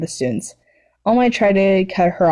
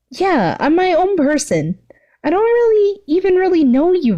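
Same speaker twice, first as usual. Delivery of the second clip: put-on high, hesitant young hero; a jittery teen boy; nervous boy voice; 11 semitones higher than usual. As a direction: push the voice up, flustered and unsure. Midoriya voiced in story.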